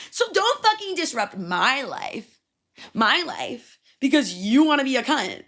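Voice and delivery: high, hard-stressed road-rage tirade; angry retold rant. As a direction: re-enact yelling at another driver. Re-enacting road rage argument.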